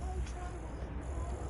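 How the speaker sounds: woman pushing a weed cake on him; offering woman. Retold or imitated. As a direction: coax him high and sweet. Retold woman offering edible.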